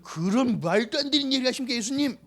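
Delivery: a flustered disciple arguing with his teacher; worked-up Peter. Retold or imitated. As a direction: act Peter hot-headed and protesting. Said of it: "우욱해가지고".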